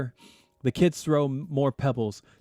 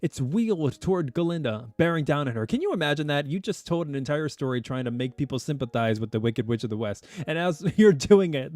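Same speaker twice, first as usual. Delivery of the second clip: ordinary delivery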